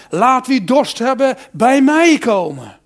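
loud, slow cry to the crowd; Jesus calling out. Acted. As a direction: call it out across the temple. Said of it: "en hij riep".

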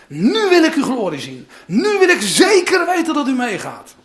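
loud, rising to a shout; Moses demanding. Acted. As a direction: demand it like Moses, not backing down. Voicing Moses demanding God's glory.

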